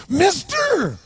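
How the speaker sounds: high-pitched bizarre holler; loud, squawky mock-drunk call. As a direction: imitate a weird drunk calling out, high and loud. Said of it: "really bizarre looking sounding voice".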